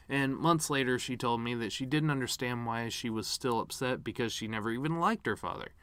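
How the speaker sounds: usual speaking voice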